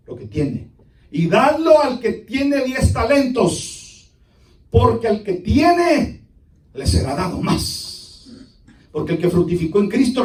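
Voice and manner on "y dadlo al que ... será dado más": slow, emphatic preaching; proclaimed judgment. Acted. Master ordering the talent given away, slow proclamation. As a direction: proclaim it slowly and forcefully.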